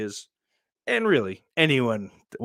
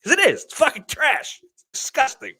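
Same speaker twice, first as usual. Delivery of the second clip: high, hammed-up outrage; mock disgusted outburst; 6 dB louder than usual. Telling the comedians Gatorade with spaghetti is trash, high mock outrage. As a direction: squeal it like it's obvious. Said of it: "I'm like".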